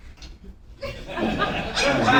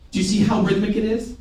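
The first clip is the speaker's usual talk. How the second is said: ordinary talk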